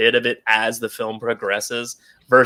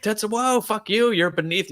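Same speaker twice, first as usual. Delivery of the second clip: mock taunt; 9 semitones higher, 3 dB quieter than usual. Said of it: "he's like".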